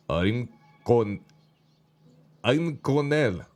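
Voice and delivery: tentative attempt at a German accent; deliberate, slow German-accented voice; hard German accent. A narrator's reading. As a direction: introduce yourself in a put-on German accent. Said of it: "he said with a hard German accent".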